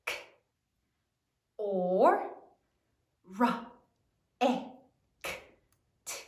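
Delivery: "K, or, ra, e, k, t" as isolated sounds with gaps; sounding out. Shown as a demonstration. Teacher tells class to sound it out, "K, or, ra, e, k, t" sound by sound. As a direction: voice each sound of "K, or, ra, e, k, t" alone. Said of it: "Sound it out".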